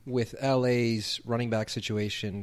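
usual speaking voice

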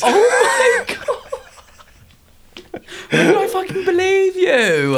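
very high laughing shriek into a crying laugh. Real, not acted. Disbelieving how easily her friend fooled her, laughing shriek. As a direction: laugh till near tears.

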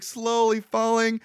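usual speaking voice

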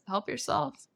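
calm answer from the medicine spirit. Retold or imitated. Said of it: "this voice just kinda goes".

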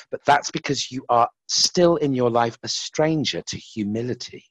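lightly voiced monk, quiet and tender; gentle wise monk. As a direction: soothe him with calm wisdom. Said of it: "And he's like".

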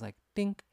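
high-pitched dink sound effect; ping it out like metal struck; imitated dink. Mimicking the metal noise on his car, high dink.

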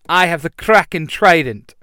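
loud weapon call; proclaiming yell. A narrator's reading. Blue Ranger calling up his trident, loud proud yell. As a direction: announce it boldly at volume. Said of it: "the Blue Ranger yells".

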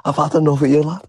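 losing his temper, real and rising; angry outburst. Real, not acted. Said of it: "You gotta calm down".

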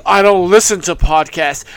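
low, dismissive mock quote; deep-voiced mocking imitation; mocking Taz. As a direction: drop low to imitate a self-important host. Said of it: "after saying".

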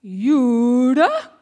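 loud, high, drawn-out teacher imitation; frowning, incredulous question. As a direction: stretch the word into a loud rising question. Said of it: "frowns and goes".

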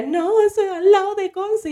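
high-pitched imitation of an aunt; aunt's alarmed correction. Aunt correcting niece about the quake's location, high alarmed voice.

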